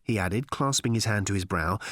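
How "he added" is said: ordinary delivery, nothing marked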